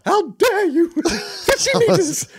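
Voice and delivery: shrill high prim outrage, laughing through it; high mock-outraged gasp at the ruffians; pearl clutcher. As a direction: play a scandalised fifties school mum. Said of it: "if I was a pearl clutcher".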